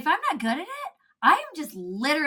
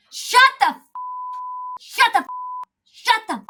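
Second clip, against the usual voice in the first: high, loud yelled rebuke, cut off each time; mock scream; 13 semitones higher, 5 dB louder than usual. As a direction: shout it at her like a fed-up mentor. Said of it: "she'd be like / scream at me".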